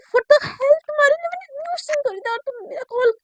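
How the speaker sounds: high, loud, fast indignant shout; hammed-up furious yell at a friend who hung up; outraged yell. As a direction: blast it out in disbelief. Shaili yells after hang-up.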